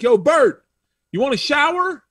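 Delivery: high, loud retold holler; calling out. Comedian acts [name] inviting him from the trailer, calling out. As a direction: imitate hailing a friend across the lot.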